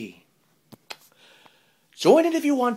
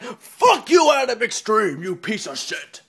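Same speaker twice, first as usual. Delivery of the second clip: hammed-up angry shout; mock yelling, loudest at the start; mock flamer rant. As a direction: imitate an outraged online hater. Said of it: "They're gonna be like".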